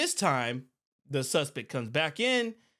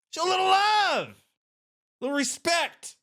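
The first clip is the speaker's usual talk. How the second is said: exasperated shout; 10 semitones higher, 3 dB louder than usual. Real, not acted. Pleading with the man.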